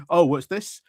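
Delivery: ordinary talk, nothing marked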